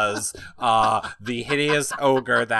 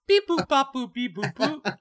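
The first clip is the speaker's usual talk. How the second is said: high beeping robot babble; toy robot voice; 14 semitones higher than usual. Pretend. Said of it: "actually sounds like this".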